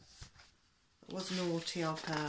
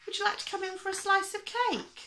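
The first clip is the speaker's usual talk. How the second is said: put-on very high, quick, louder old lady voice; coaxing old lady; 14 semitones higher, 5 dB louder, faster than usual. A narrator's reading. Little old lady offering the police chief cake, high and coaxing. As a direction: invite sweetly in a high-pitched put-on voice.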